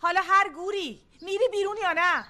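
angry. Acted. Woman ordering a pestering customer out, angry. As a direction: lose patience, pushing the pitch up.

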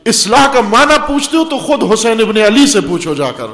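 fiery preaching. Acted. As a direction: climb to a preaching peak. Preaching builds to full force.

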